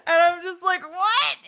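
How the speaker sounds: can't believe your ears; stunned. Real, not acted. Stunned by the casting news.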